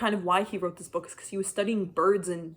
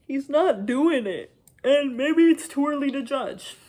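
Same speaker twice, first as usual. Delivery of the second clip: louder, higher, slow frustrated verdict; emphatic complaint; 6 semitones higher, 7 dB louder than usual. Real, not acted. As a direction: drive home the frustration.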